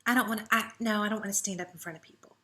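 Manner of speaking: soft, hurried, shrinking no; timid refusal. Retold or imitated. Team members turning down teaching a class, soft timid refusal. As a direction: back away from the idea nervously. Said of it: "And they're going".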